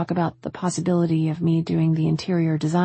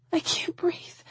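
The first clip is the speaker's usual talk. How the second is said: high, fading whisper of distress; breathless whisper; 12 semitones higher, 6 dB quieter, slower than usual. A narrator's reading. Woman collapsing into his arms, whispering. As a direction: whisper it with no air left.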